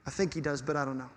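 put-on child's soft unsure mumble; little grandson. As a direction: play a shy small child unsure of his answer. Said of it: "And he goes".

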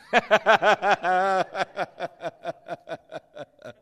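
long, loud laugh tapering off; deliberate, sustained crowd-leading laugh; practice laughing. Shown as a demonstration. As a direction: burst out in ha-ha-has and let them trail away. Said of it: "Now let's laugh again".

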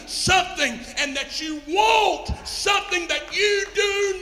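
high, shouted sermon climax; full-force preaching. Real, not acted. Preacher exhorts the congregation about need, shouting. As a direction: drive each word home at full cry, stretching key words.

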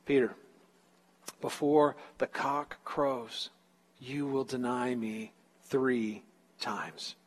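slow, hushed, with weighty pauses; solemn prediction. Retold or imitated. Jesus foretelling Peter's denial, solemn and slow. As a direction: let each phrase fall slowly and quietly.

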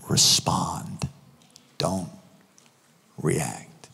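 low, soft words with long pauses; hushed emphasis. Real, not acted. Preacher driving home the lesson, hushed solemn delivery. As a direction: let each word drop low and quiet. Closing maxim, slow and hushed.

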